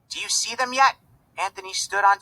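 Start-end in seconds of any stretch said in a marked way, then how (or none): 0.1-1.0 put-on high anxious child voice. A narrator's reading. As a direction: ask it high and worried.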